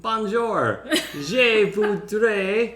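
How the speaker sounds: high, slow, painstaking learner's French; cringy French attempt. Customer at Montreal Starbucks ordering, cringy French attempt. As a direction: order in careful, hammy beginner French. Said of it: "my cringy attempt".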